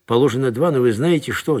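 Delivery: ordinary delivery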